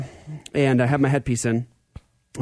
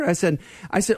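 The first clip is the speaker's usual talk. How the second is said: ordinary delivery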